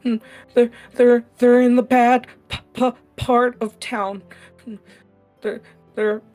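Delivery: put-on high, halting child voice on the verge of tears; a frightened kid stuttering through tears; scared stammering orphan. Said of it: "he just starts to cry a little bit".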